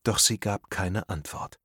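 plain reading voice

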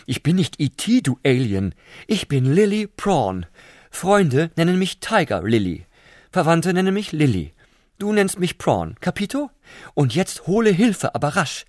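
high, loud and bossy prawn voice; haughty prawn. Acted. Prawn lecturing the slug, high haughty diva voice. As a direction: order him around with diva arrogance.

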